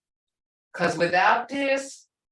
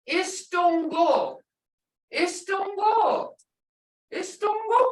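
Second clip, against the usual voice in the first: "Istungo" sung out high and slow; high, stretched model of "Istungo"; tones demonstrated; 11 semitones higher, slower than usual. Shown as a demonstration. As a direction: say "Istungo" slowly, high-pitched, showing its tones. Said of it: "Hear those tones?".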